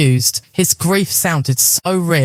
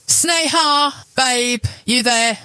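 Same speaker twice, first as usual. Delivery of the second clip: casual and light; 7 semitones higher, slower than usual. A narrator's reading. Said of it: "His voice was casual, light".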